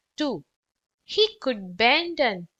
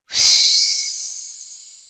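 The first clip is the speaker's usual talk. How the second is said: loud breathy whoosh of air; 7 dB louder than usual. A narrator's reading. Narrator makes the fanning blast, loud whoosh. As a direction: blow it out like a gust.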